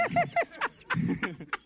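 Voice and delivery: high cackle. Real, not acted. Host laughing at the band's honest answer, high cackle. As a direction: burst of high-pitched laughter.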